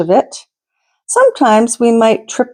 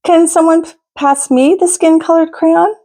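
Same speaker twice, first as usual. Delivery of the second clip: put-on child's voice, clearly higher; another friend asking; 7 semitones higher than usual. Reader voicing another classmate asking across the room, higher. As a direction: pitch it up as a young classmate.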